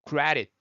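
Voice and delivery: CREDIT as "CRA-rit", falling, D tapped; flapped D. Shown as a demonstration. Teacher modelling the easier sound, flapped D. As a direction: tap the D in CREDIT lightly. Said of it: "소리가 플랩 돼서".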